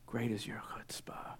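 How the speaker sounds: soft whispered praise; breathy stage whisper; hushed awe. Acted. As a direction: whisper it with reverent amazement. Repeats Jesus' praise in awe.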